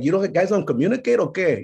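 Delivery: put-on high scolding boy voice; exasperated kid. Boy telling his parents to communicate, exasperated.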